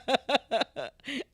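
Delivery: soft drawn-out chuckle; laugh. Real, not acted. Co-host amused by the unsaid joke, soft laughing. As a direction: chuckle on, fairly quietly.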